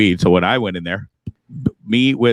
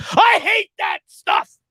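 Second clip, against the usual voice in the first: mock disgust; slower than usual. Real, not acted. Host dismissing politics talk.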